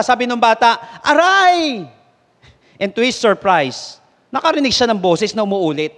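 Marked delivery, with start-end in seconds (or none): 1.0-1.8 sharp high yelp of pain; child's cry of pain. Acted. Boy yelping as he trips on the mountain. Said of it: "napasigaw yung bata".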